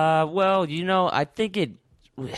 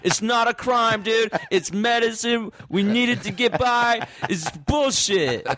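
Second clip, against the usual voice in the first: high-pitched impassioned mock rant; mock righteous protest; 6 semitones higher than usual. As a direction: protest like a wronged activist, slowing down. Mock rant defending weed smokers.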